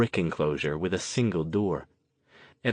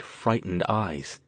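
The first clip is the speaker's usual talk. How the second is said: ordinary delivery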